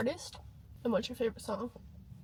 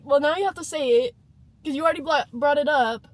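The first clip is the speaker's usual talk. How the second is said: louder, fast, frustrated retort; exasperated; 3 semitones higher, 8 dB louder than usual. Retold or imitated. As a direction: push back hard, fed up. Retold argument with friend.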